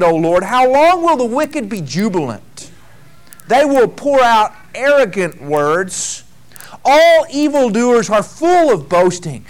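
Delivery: loud, slow psalm reading from the pulpit; preacher reading scripture to the congregation, loud and slow. Acted. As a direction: proclaim the psalm with weight. Reads a psalm aloud.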